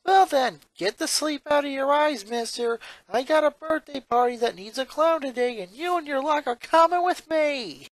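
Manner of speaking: high, bright comedian sister voice; Luann voice. Acted. Older sister teasing her brother awake, chirpy high voice.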